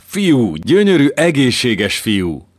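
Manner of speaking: high, loud, slowing at the end; joyful shout. Acted. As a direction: announce the baby boy with delight. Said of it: "harsogta az öreg bába".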